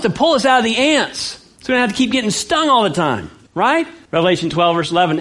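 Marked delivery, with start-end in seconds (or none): none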